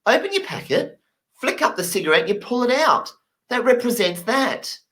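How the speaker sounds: very high, laughing explanation; high explaining voice. Retold or imitated. Egyptian explaining the cigarette sign, high and laughing. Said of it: "He's like".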